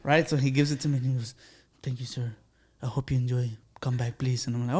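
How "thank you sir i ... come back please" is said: imitated waiter, very soft and low; low-talking waiter. Waiter handing over the bill, low polite murmur. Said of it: "He's like that".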